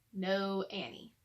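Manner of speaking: ordinary delivery, nothing marked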